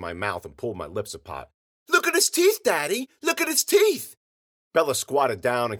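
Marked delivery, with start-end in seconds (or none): 1.9-4.2 put-on very high, loud child's squeal; excited little girl. A narrator's reading. Ella showing Daddy the captive's teeth, excited squeal. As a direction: clamour for Daddy to look.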